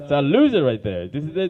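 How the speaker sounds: ordinary talk, nothing marked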